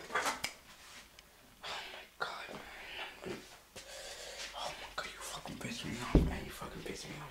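seething under the breath; angry whisper. Real, not acted. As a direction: whisper it, fuming. Seething reaction to the video.

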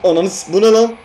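high, loud frightened yell; startled yell. Real, not acted. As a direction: yell out in real shock at the jumpscare. Jumpscare, enemy appears suddenly. (yüksek sesle irkilmiş bağırış)

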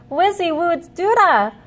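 lifted, hopeful magic words; cried spell. Acted. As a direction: cry out the spell bravely. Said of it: "cried Keith".

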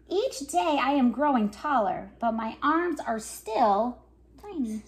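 lively picture-book reading with voices for each character; reading to children. Acted. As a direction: read it like story time for little kids. Reads picture book aloud.

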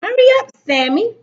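high, loud, quick motherly call. A narrator's reading. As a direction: call up to a child to hurry. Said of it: "his mother called".

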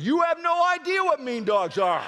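high, loud dismissive dad; scornful dad. Retold or imitated. Dad scoffing at his son's fear of dogs, loud. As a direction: scoff at the kid like a tough old father. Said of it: "He said".

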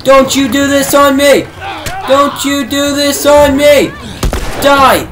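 high, loud frantic pleading; panicked yelling. Real, not acted. Player panicking as the clicker keeps coming, frantic yelling. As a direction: scream it as the monster won't die.

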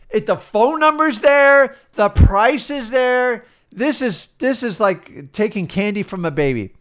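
high-pitched, drawn-out gushing; mock elation. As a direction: imitate a thrilled beginner who struck gold. Mimics duped beginner finding easy deals.

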